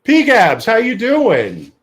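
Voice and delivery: high, loud, drawn-out hello; big delighted shout-out; excited greeting. Real, not acted. Calling out to chat regular.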